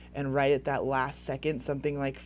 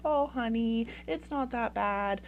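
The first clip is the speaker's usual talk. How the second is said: high, cooing reassurance; sweet, empathetic; 5 semitones higher than usual. Acted. Dismissive parent soothing a child, sweetly. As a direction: soothe the child, stretching the words. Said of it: "probably more empathetic".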